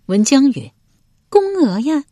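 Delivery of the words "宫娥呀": high female character voice; high-pitched lady answering her husband; voiced noblewoman. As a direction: answer in a high, put-on lady's voice.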